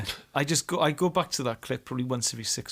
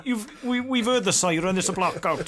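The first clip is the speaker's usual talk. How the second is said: higher-pitched officious warden; Pertwee's Dad's Army warden; 5 semitones higher, 4 dB louder than usual. Acted. Warden scolding people over the blackout, higher and officious. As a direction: play an interfering wartime air raid warden. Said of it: "Pertwee from Dad's Army comes in".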